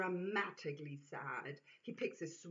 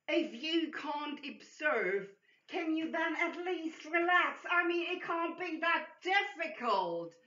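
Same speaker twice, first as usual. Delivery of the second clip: exhausted disgust; 9 semitones higher, 5 dB louder than usual. Acted. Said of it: "mixture of exhaustion and disgust".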